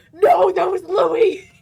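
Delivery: loud, slow, laughing shout. Real, not acted. Streamer loudly corrects chat through laughter.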